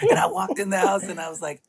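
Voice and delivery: normal talking voice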